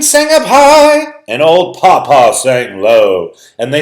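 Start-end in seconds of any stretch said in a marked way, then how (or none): 0.0-1.1 high voice. A narrator's reading. Reads "sang up high" high.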